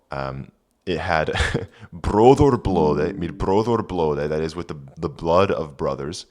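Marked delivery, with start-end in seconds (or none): 1.9-4.3 put-on slow Old English reading. Shown as a demonstration. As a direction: Brodor Blode, rolled r, long vowels. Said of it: "reads Old English text".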